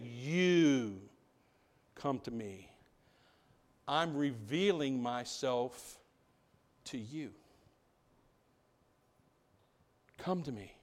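slow, low, softening with long pauses; gentle invitation. Acted. Jesus revealing himself to the forgotten, gentle and hushed.

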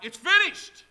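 high, very loud cry; shout. Acted. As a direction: shout it out in triumph. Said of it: "thought he would shout".

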